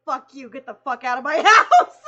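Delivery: laughing fake outburst; laughing mock yell. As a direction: blurt it out loud while cracking up. Imagined outburst at the friend.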